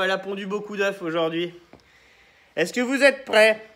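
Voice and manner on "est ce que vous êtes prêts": ordinary delivery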